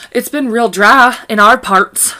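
slow, loud put-on country drawl; playful country-folk accent; mock country accent. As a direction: stretch it out in a hammy country twang. Jokey country accent, drawn out.

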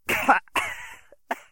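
high, choked wheezing cough. Acted. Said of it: "He wheezed".

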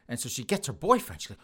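usual speaking voice

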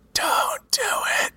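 high, breathy whispered voice; eerie sword whisper. Acted. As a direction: whisper like a cursed blade.

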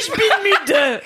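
allemand étiré, haut perché et rieur; Fabrice Florent. Retold or imitated. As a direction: imite quelqu'un qui traîne sur son unique phrase d'allemand en riant. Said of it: "Et après, il rigole". (imitation moqueuse, très lente et plus aiguë)

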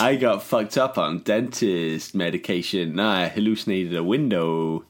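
low, slow stoned drawl; stoned hippie. Pretend. Mocking friend about dentist drugs, low slow stoner voice. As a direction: play a spaced-out hippie.

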